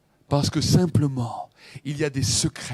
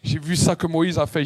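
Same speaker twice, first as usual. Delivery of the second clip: ordinary talk, nothing marked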